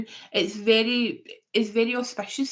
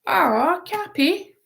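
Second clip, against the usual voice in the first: playful pet name; 8 semitones higher, 4 dB louder, slower than usual. Pretend. Playful nickname for Capricorn.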